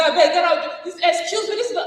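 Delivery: high, brushing someone off; dismissive retort. Retold or imitated. Colleague dismissing the woman leader, high and curt. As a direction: wave the person away, mockingly.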